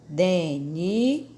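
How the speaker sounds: "Denise" broken into three slow, separate syllables; "Denise" stretched, with gaps between the syllables. Shown as a demonstration. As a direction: sound out "Denise" for a class, syllable by syllable. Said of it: "leia as palavras outra vez, observando o esquema".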